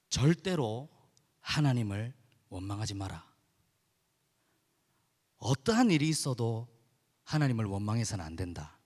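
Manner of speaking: a mother's grave, steady admonition; firm motherly counsel. Retold or imitated. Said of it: "딱 이렇게 말씀하셨어요".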